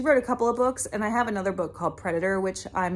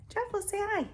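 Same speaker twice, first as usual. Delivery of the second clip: much higher, sing-song pet voice; 13 semitones higher, 7 dB quieter, faster than usual. Real, not acted. Coaxing her cat to greet the viewers, high cooing pet voice.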